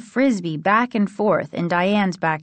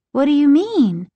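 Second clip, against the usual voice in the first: high, louder, startled question; taken-aback question; 8 semitones higher, 6 dB louder than usual. A narrator's reading. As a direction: demand an explanation, pitch and volume up. Alarmed question in acted dialogue.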